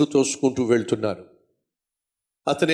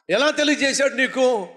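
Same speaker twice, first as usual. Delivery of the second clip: much higher, surprised nurse asking; surprised question; 12 semitones higher than usual. Retold or imitated. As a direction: ask it high in disbelief. (ఆశ్చర్యంగా ఎత్తైన స్వరంతో అడగడం)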